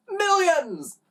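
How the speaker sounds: high, amused gloating; chuckling boast. A narrator's reading. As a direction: top the boast with mirth in the voice. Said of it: "his wife chuckled".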